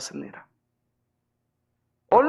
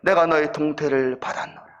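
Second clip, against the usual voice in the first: lightly voiced divine voice, lower; a hint of God speaking, low and solemn; understated low voice from heaven; 6 semitones lower than usual. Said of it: "이런 음성이 들리는 겁니다".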